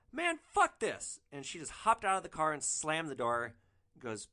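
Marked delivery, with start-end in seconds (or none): 0.1-1.2 very high, slow fed-up outburst; high indignant exit. Retold or imitated. Woman in the car quitting the deal, very high and slow. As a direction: storm out in a high voice. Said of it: "And then she goes".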